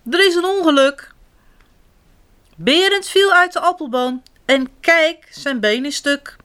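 high-pitched urgent report; storybook little sister in a panic; alarmed. A narrator's reading. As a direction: blurt out bad news as a worried child.

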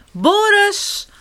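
high urgent call; first alarmed shout for a brother, pitched right up; little sister calling for help. A narrator's reading. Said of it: "roept zusje Sam".

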